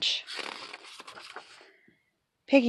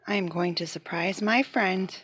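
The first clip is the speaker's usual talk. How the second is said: plain reading voice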